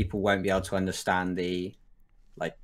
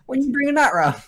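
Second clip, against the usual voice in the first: high, louder put-on roadman voice; 5 dB louder than usual. Teaching Americans what roadmen sound like, roadman. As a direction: call it out like a mock roadman. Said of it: "what roadmen sound like".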